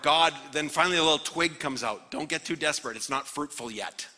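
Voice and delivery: ordinary talk, nothing marked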